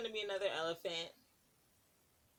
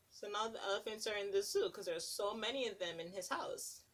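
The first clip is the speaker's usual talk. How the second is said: ordinary delivery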